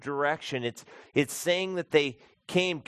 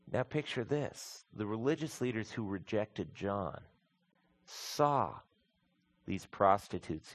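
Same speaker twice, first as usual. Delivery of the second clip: softer, earnest preaching to the flock; quiet pastoral appeal; 5 semitones lower, 7 dB quieter than usual. Acted. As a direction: draw them in gently.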